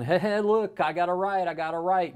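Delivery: loud, high mocking "I got a right"; mocking whiny protest. Mocking the open carrier to the host, loud and high.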